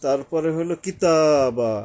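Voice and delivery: কিতাবা said slowly, the তা drawn out one alif; কিতাবা, slow with a long তা; elongated vowel. Shown as a demonstration. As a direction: stretch the তা in কিতাবা. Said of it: "একালিপ টানতে হবে".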